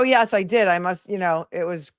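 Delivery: ordinary talk, nothing marked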